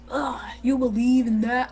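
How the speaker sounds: mock the doubter's scoffing question; drawn-out scoff at a silly belief; mocking skeptic. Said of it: "When people are like".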